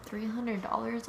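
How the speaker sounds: slow whispered disbelief, rising; shocked whisper. Retold or imitated. Shocked at towing price.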